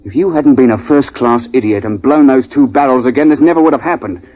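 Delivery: ordinary talk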